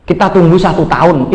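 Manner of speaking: normal talking voice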